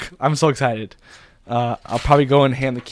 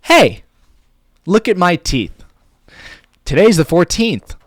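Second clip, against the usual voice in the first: loud high "Hey", then emphatic lines; high, emotive hook with deliberate pacing; very expressive; 6 semitones higher, 6 dB louder, slower than usual. Shown as a demonstration. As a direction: perform it big for the internet. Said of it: "You really have to be, like, expressive".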